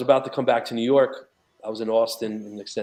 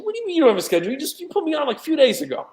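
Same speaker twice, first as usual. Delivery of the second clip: higher, indignant disbelief; incredulous protest; 14 semitones higher, 3 dB louder, much faster than usual. Retold or imitated. Retelling confronting the interview staff, mock indignant disbelief. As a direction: push back, baffled at the desk.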